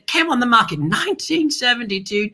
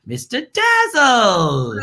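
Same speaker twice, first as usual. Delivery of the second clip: showman intro; 3 semitones higher, much slower than usual. Real, not acted. Host brings on his good friend, booming drawn-out announcement. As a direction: draw the name out like a game show host.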